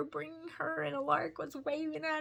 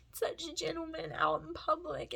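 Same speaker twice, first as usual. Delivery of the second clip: ordinary talk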